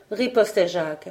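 ordinary delivery, nothing marked

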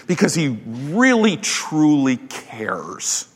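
truly cares slowed and softened; earnest and hushed; tender emphasis. Real, not acted. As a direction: drop soft and slow on truly cares.

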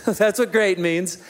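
ordinary talk, nothing marked

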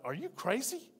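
mild mock astonishment at an accusation; incredulous. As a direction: voice jumps up in hushed protest. Accused man's retold incredulous reply.

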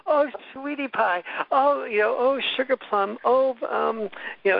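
high-pitched, syrupy imitation of a flirtatious interviewer; gushing flirt. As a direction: coo and fawn in a mock-female high voice. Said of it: "she'll touch me on my leg and go".

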